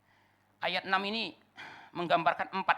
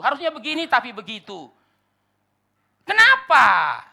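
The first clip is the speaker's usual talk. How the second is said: high, loud, drawn-out proclamation; full-force preaching; 12 semitones higher, 18 dB louder, slower than usual. Acted. Preacher on life's dissatisfaction, impassioned and loud. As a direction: thunder each contrast out to the congregation.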